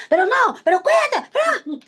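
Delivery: high, loud, hammed-up stressed cry; mock stressed outburst. Speaker acting out her own stressed snapping, high mock cry. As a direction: act out stress boiling over.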